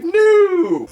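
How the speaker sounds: high, loud, drawn-out wail; hammed-up cry of dread; mock dismayed cry. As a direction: imitate a panicked howl. Retold horrified reply to the call.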